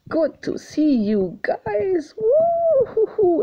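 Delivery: high, slow, hooting horse voice; voicing the horse. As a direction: play the happy horse calling out. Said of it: "and he says".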